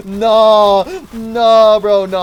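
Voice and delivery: high, loud panicked no; panicked yell. Real, not acted. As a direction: scream as they catch you. Panicked as caught.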